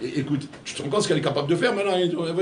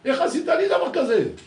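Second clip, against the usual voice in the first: friend scolding a betrayal, hurt and raised; reproachful friend; 11 semitones higher, 5 dB louder than usual. Retold or imitated. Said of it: "tu reproches ça à un ami en lui disant".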